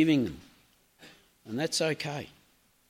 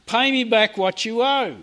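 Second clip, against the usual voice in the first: higher, louder angry demand; growling demand; 5 semitones higher, 5 dB louder than usual. Acted. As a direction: snarl the demand for payment.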